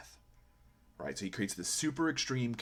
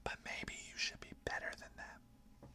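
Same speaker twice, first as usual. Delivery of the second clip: a sly, breathy whisper of doubt; whispered inner voice; 10 dB quieter than usual. Retold or imitated. Inner voice undermining the listener, whispered. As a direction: hiss it softly like a voice in your head. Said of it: "Or it comes in and says".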